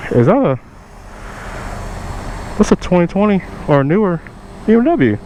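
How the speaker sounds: fake-naive jab at his demands; sarcastic question. To the buyer demanding money, rising sarcastic question.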